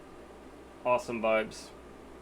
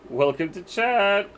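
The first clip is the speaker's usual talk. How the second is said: voice crack; 7 semitones higher, 4 dB louder than usual. Real, not acted. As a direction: voice cracking up high. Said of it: "Voice crack".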